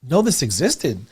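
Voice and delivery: high-pitched, incredulous question; retold shock. Teacher recalls his shock at the technique, acted astonishment. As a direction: act out being baffled, starting high. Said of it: "I was shocked".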